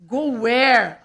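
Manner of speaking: loud, startled question; a sudden loud disbelieving cry. Retold or imitated. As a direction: caught off guard, blurt it out. Startled by late pub plan.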